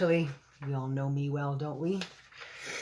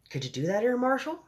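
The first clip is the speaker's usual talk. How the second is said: first try at the Queen, rising question; lightly voiced Queen; 11 semitones higher than usual. A narrator's reading. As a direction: start normally, lift high on Air Marshal. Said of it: "said the Queen".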